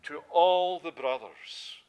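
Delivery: "all" drawn out slow and loud; stress on all. Shown as a demonstration. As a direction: lean hard on "all" in "to all the brothers". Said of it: "underline it in your Bibles".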